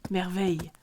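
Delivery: ordinary delivery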